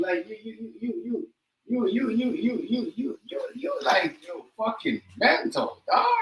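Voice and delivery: high, stuttering, exasperated sputter; sputtering outrage. Real, not acted. Ranting at the rapper, sputtering with disbelief. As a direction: trip over your words in disbelief.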